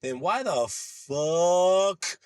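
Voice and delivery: loud, high, drawn-out mock exasperation; exasperated challenge. Friend confronts him about changing speech, exasperated drawl. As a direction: stretch it out, rising into loud disbelief.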